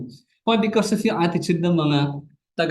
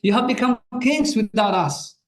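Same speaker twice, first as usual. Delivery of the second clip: higher, declaimed mock tribute; sarcastic; 7 semitones higher than usual. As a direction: quote it with pointed sarcasm. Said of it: "Notice yung kanyang sarcasm doon".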